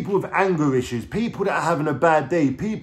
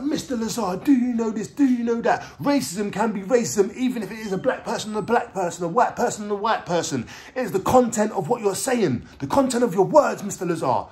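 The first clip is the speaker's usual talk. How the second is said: high-pitched, lecturing judge impression; angry judge; 8 semitones higher than usual. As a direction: imitate a judge scolding point by point.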